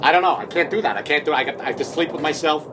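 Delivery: ordinary talk, nothing marked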